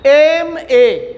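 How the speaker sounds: एम ए drawn out very slowly, each letter separate; एम ए stretched out letter by letter; slow demonstration. Shown as a demonstration. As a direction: spell out एम ए slowly so students hear the vowel start. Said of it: "इसका pronunciation देखिए कैसे आप बोल रहे हैं".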